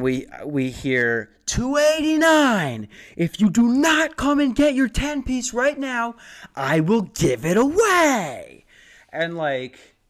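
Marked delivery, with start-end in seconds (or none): 1.4-8.6 high, loud, drawn-out order call; mock furious yell. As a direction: imitate a fed-up counter worker yelling at customers. Imitated worker yelling out an order.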